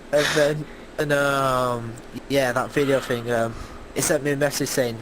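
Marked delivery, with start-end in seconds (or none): none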